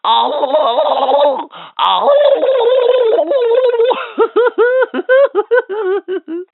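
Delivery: high loud gobbling; turkey gobble. Acted. Toy showing the child a turkey's call, high gobble. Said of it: "A turkey says like this".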